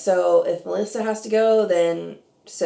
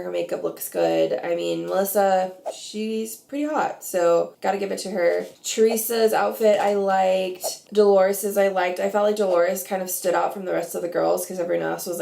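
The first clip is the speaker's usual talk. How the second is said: ordinary talk, nothing marked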